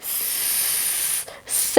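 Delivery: soft breathy hiss "स" with no voice; a long whispered "स" to show the sound. Shown as a demonstration. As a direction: hiss "स" like a snake, quietly. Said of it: "its pronunciation is like".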